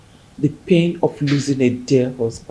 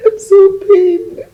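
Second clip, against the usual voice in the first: tearful, loud and slow, high wail; slow high sobbing cry; 9 dB louder, slower than usual. Acted. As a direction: break down sobbing on "pained". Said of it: "stop crying".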